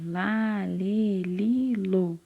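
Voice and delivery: "lá, lê, li, lo" as an evenly drawn-out chain; syllable recitation. Shown as a demonstration. Teacher listing the L family, each syllable lengthened. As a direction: recite "lá, lê, li, lo" with each vowel held. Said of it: "da família do L".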